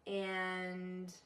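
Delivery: Californian uptalk, lifted sentence ends; California accent. Shown as a demonstration. Coach showing the West Coast now thing, California uptalk. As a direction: bring phrase ends up like a Californian. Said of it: "bring it up at the ends of your sentences".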